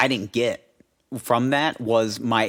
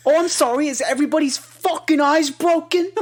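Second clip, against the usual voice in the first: much higher hammed-up angry dad; angry Jersey dad; 4 dB louder than usual. Mimicking the dad arguing with mom, much higher mock voice. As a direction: squeal the dad's rant in a mock voice. Dad's sarcastic rant voiced.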